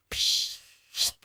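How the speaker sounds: short soft "psh" hiss; imitated tap water. Boy turning taps on, imitated spurting water. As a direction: make running water with a hiss.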